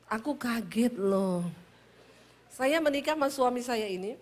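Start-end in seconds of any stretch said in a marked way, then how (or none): 0.1-1.4 slow, lower, mild Solo Javanese complaint; understated shock in a drawn-out Javanese lilt; startled Solo man. Retold or imitated. Solo husband telling his wife he is startled, unhurried Javanese accent. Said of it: "Orang Solo kaget".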